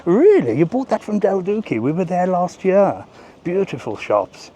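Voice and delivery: high, swooping posh chat; mock posh friend. Posh friend replying about a Del Duque purchase, mock posh. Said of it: "thinking of a posh name".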